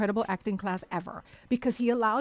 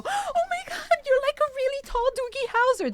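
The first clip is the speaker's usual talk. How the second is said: very high, loud fan shriek; acted-out gushing in a squeaky pitch; retold starstruck squeal; 5 dB louder than usual. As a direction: spaz out like an overwhelmed fan. Retells her starstruck outburst.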